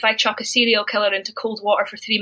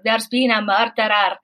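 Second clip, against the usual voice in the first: exaggerated Scottish accent with rolled R's; fake Scottish accent. Shown as a demonstration. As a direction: overdo the R's like a bad Scottish impression. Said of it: "emphasize the R's".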